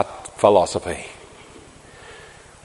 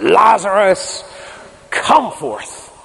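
commanding call; 8 semitones higher, much slower than usual. Acted. Jesus calls Lazarus from grave.

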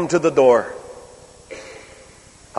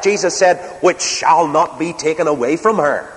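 ordinary talk, nothing marked

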